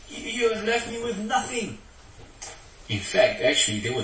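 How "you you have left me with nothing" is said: high complaint broken by a laugh; aggrieved Micah. Retold or imitated. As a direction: wail the loss, higher. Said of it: "He said".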